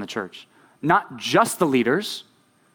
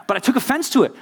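higher-pitched indignation; real rising irritation, pitched up; mad about it; 10 semitones higher, faster than usual. Real, not acted. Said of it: "I'm mad about it now".

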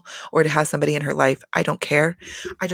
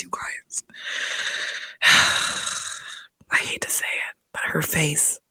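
confiding whisper; 5 dB quieter than usual. Real, not acted. Vlogger whispering a catty confession to viewers, hushed. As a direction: whisper it like a guilty secret.

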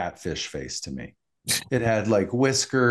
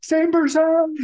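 panicked yell; 5 dB louder than usual. Retold or imitated. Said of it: "Home Alone moment".

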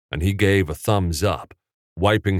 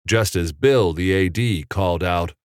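plain reading voice

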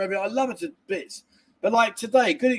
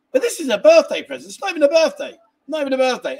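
exasperated outburst; 7 semitones higher, 7 dB louder than usual. Real, not acted. Ranting about stepdaughter's sulk.

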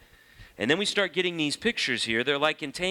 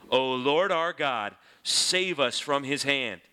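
prayer reading; 3 semitones higher, slower than usual. Acted. Reading Hezekiah's prayer aloud.

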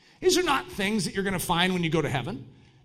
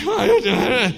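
mock hesitation; 3 semitones higher, 7 dB louder than usual. Hesitating at toe threat.

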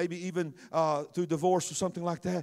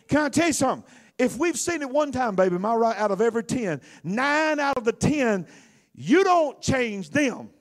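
loud, higher-pitched sermon delivery; preaching; 8 semitones higher, 11 dB louder than usual. Acted. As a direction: preach it hard to the room. Preaches at full force to singles.

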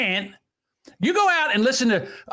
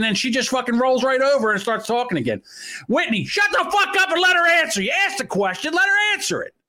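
angry high shout; escalating comedy rant; 4 semitones higher than usual. Real, not acted. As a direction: yell it at her. Yelling at Whitney.

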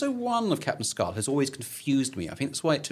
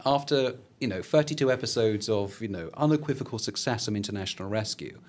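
ordinary delivery

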